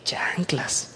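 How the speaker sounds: breathy whisper under the breath; hushed whisper of dismay. Retold or imitated. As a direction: whisper it like a stunned aside. Exclaims in surprise, whispered.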